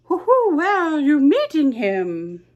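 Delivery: an owl calling down sweetly; hooting owl. Acted. Owl to the mouse, high hooting invitation.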